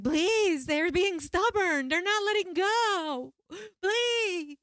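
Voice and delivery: high, loud, slow frantic begging; screaming plea. Retold or imitated. Pleading with shamans during ceremony, high loud screaming. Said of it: "I couldn't stop being like".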